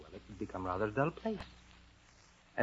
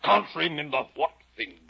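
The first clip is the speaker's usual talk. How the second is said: loud, high, imperious question; caterpillar's stern challenge; 6 semitones higher, 8 dB louder than usual. Acted. Storyteller as caterpillar questioning Alice, stern and loud. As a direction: demand it forcefully. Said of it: "the caterpillar said".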